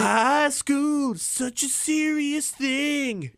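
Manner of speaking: high, very slow melodramatic voice; Stewie teen drama parody. Retold or imitated. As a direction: overdramatic teen, stretch every word. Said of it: "word for word what Stewie says".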